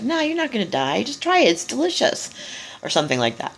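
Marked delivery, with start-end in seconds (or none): 0.0-2.3 coaxing snake. Retold or imitated. Snake tempting Eve, voiced.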